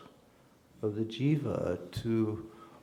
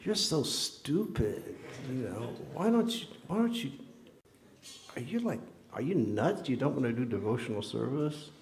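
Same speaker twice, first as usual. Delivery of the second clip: mock inner scolding; 3 semitones higher, 3 dB quieter than usual. Scolding the unwilling devotees in his own mind, mock exasperated. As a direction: berate them in your head, half-joking. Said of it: "in my mind, I was saying".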